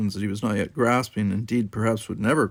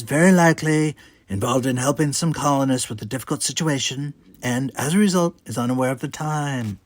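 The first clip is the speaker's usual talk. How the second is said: higher, louder, suave diplomat; a poised captain steering the table; Trump's smooth voice; 4 semitones higher, 3 dB louder than usual. Acted. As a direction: smoothly cover for him, with a pointed close.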